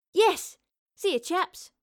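high, loud yelp of triumph; excited, pitched right up; gleeful cry. Acted. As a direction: cry out a jubilant goodbye. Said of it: "Astrid cried".

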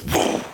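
airy, blown whoosh; imitated whoosh. Shown as a demonstration. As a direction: blow out a whoosh like something expanding. Imitates mental map expanding.

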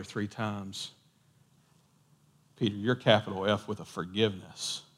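low, soft, tender correction; acted calm, forgiving voice; gentle reassurance. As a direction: quietly reassure someone who feels a failure. Voices Jesus reassuring Peter.

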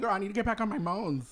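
normal talking voice

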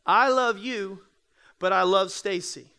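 LOVE hit loud both times, second love pointed; stress on love. Shown as a demonstration. Teaching the congregation equivocation, stressing the second love. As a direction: lean hard on love, then on Stacy. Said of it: "if I said equivocally".

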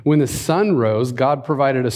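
ordinary talk, nothing marked

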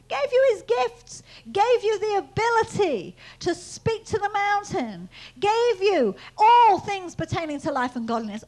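loud, high, emphatic proclamation; forceful preaching. Acted.

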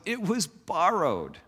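high, slow anguished cry; a hint of a distraught prophet. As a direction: plead it out in dismay. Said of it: "cries out and says".

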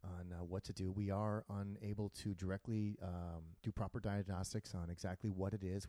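low, quiet captain announcement; calm airline captain on the intercom; pilot voice. Retold or imitated. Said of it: "And he's like".

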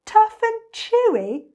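high, loud, drawn-out disbelief; slow, high-pitched indignant echo; incredulous cry. A narrator's reading. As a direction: throw it back as an outraged question. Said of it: "cried the enormous crocodile".

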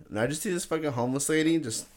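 ordinary delivery

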